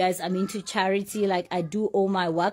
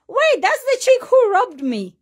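much higher and louder, an accusing stranger; angry victim; 11 semitones higher, 7 dB louder than usual. Retold or imitated. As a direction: play the robbed stranger spotting her at the light. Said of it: "looking at me like".

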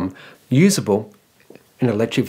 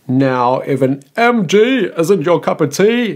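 a hammed-up posh English accent, higher and louder; bad English accent; 6 semitones higher, 5 dB louder, slower than usual. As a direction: put on a jokey, overdone British accent.

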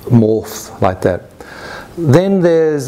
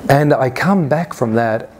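ordinary delivery

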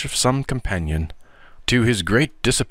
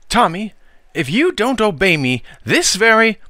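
threatening aunt; 12 semitones higher, 4 dB louder than usual. Acted.

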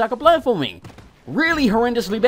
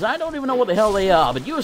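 ordinary talk, nothing marked

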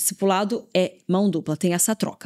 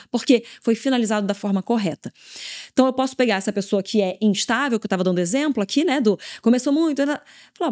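usual speaking voice

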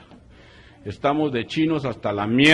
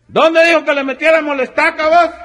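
very high, loud bumpkin voice; squeaky yokel; 13 dB louder than usual. Acted. Villager asks his partner at the open coffin, very high and loud. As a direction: ask it like a panicked country boy.

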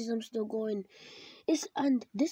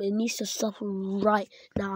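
normal talking voice

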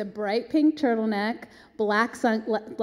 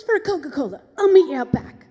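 higher star impression, ending loud; 5 semitones higher, 8 dB louder than usual. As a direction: build to a big diva punchline. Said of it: "She goes".

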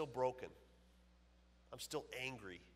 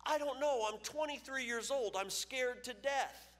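high, loud, slowing to a frightened confession; young pitcher confessing his fear to the coach; scared rookie; 12 semitones higher, 4 dB louder, slower than usual. Retold or imitated. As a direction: plead it like a terrified kid.

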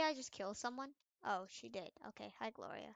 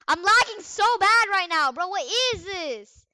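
loud higher complaint; frustrated yell; 7 semitones higher, 13 dB louder than usual. Real, not acted. Gamer lagging, loud frustrated yell. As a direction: complain loudly about the lag.

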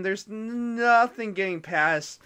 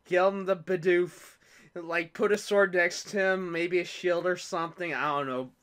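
ordinary talk, nothing marked

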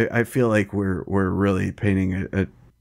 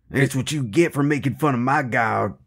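gloating cop; 6 semitones higher than usual. Acted. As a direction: scold the fallen culprit with mock righteous triumph. Acting vengeful cop gloating.

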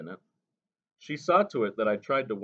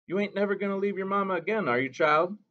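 ordinary delivery

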